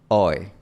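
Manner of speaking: "Oi" a la inglesa con final más abierto; diptongo inglés. Shown as a demonstration. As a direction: suelta el "oi" inglés. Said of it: "termina en una posición más abierta". ("oi" inglés abierto)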